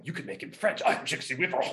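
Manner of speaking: a hint of his father, playful suggestion; dad tossing out a goofy idea; imitating dad. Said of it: "my dad was like".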